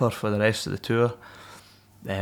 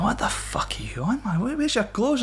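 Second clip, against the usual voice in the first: high, incredulous voice of a startled mate; baffled mate; 12 semitones higher than usual. Retold or imitated. A mate at the door asking about his clothes, high and incredulous. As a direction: play the mate who can't believe what he sees. Mates react to him naked.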